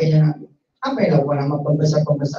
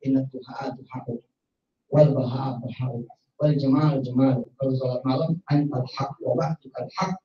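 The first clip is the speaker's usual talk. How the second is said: soft prayer recitation; 4 semitones lower, 7 dB quieter than usual. Retold or imitated. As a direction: recite it like a night prayer, hushed. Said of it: "Lu pakai dulu bahasa Nabi lo".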